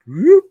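high, loud whoop; quick rising sound effect for a move; imitated whoop. Storyteller acts out the sidestep, high loud whoop.

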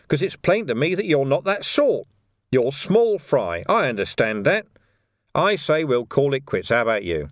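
lightly voiced interrogator, falsely reasonable; coaxing copper. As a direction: talk him round with pointed understanding. Vimes persuades the prisoner.

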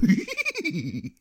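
high cartoon dog snicker; a wheezy cartoon dog laugh; Scooby-Doo laugh. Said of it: "that's how he laughs".

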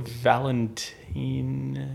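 "Valentina" drawn out syllable by syllable; slow sounding-out. Real, not acted. Trying to recall what the horse is called, "Valentina" slowly drawn out.

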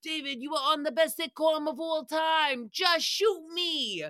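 high gushing fan voice; hammed-up high fawning; mock fawning Pete. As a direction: gush over a star. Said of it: "he was like".

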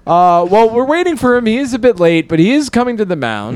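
high, projected mock sportscaster; mock announcer. Announcer waiting for the late pitcher, mock high projected voice.

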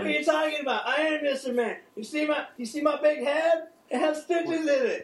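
put-on high, loud drunk Mister Met; loud drunk heckling. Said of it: "This drunk guy at the bar thinks he's Mister Met".

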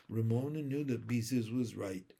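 ordinary delivery, nothing marked

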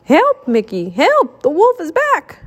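rising cries for help; urgent high-pitched alarm; calling for help. A narrator's reading. Staged cry for help in story.

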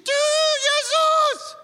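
very high, loud screamed proclamation; piercingly high mock battle cry; demonstrated shout. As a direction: scream it out at the top of your range. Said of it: "Można krzyczeć".

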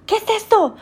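high-pitched demanding Spanish question; imitating mom. Mom spots daughter's new tattoo, imitated high alarm. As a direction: mimic a mom's sharp high-voiced discovery.

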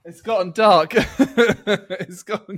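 giggling through the words; laughing. Real, not acted. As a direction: laugh while admitting it got dark. Laughing at the room darkening.

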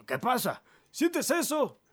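high, loud shouted question; alarmed shouting. Acted. Housemates shouting about the tremor, high and alarmed. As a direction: yell it across the farm.

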